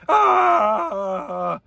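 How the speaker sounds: long, loud comic holler; Jerry Stiller. Shown as a demonstration. Lecturer repeating the mock T-Rex roar, loud comic holler. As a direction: let out a high yell that tails down. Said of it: "Like Jerry Stiller".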